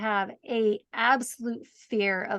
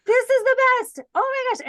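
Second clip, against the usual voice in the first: much higher, louder, gushing like on a roller coaster; hammed-up delight, forcing the fun; mock thrilled; 12 semitones higher, 6 dB louder than usual. Guest acting her forced joy during turbulence, hammed-up and higher. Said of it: "And I was like".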